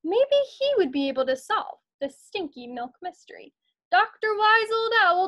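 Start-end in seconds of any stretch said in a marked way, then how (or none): 3.9-5.3 louder, higher call up to the rafters. Acted. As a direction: call the owl awake. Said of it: "called Lizzie".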